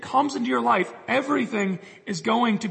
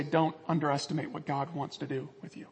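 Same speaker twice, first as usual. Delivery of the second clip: soft, low, gentle plea; tender pastoral appeal; lovingly; 7 semitones lower, 9 dB quieter than usual. Real, not acted. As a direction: lower and soften it, speaking lovingly. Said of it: "Could I just lovingly say".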